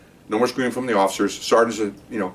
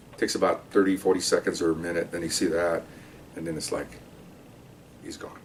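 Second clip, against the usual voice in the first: softer and lower until gone; fading to nothing; 5 semitones lower, 10 dB quieter than usual. Shown as a demonstration. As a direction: let each word drain away to silence. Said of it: "I'm doing it now, like this".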